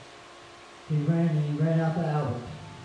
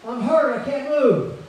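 high desperate yell of a wounded soldier; hollering for help; 10 semitones higher, 7 dB louder, slower than usual. Retold or imitated. Wounded soldier hollering for his buddy from the field, high desperate yell. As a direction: call out in pain for your friend. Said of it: "Albert hollering out for him".

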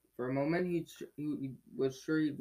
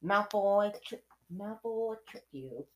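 higher, slow and deliberate Hermione; Hermione's voice; 7 semitones higher, 3 dB louder, slower than usual. Acted. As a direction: spell it out in a high, slow voice. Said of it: "said Hermione to Harry".

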